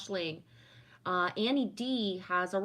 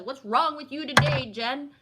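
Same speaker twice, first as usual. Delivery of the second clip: louder, higher whiny complaint; mock needy friend; 5 semitones higher, 9 dB louder than usual. Imagined crow nagging Jen for ignoring it, hammed-up whine. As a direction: ham up a clingy friend's reproach. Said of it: "No crow comes to you and says".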